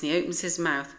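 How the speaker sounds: ordinary delivery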